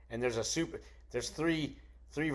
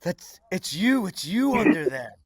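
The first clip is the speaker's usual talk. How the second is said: higher, slow, dawning surprise; 7 semitones higher, 8 dB louder, slower than usual. Retold or imitated. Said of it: "and he goes".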